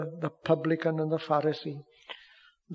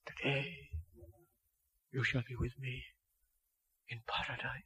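tender answer; 2 semitones lower, 12 dB quieter than usual. Pretend. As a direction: answer him gently, quietly. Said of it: "on the cross".

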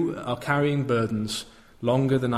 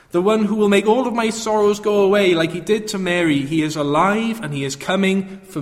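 rising, fervent preaching voice; 4 semitones higher, 4 dB louder than usual. Acted. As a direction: build the proclamation higher and louder. Preaching builds to a climax.

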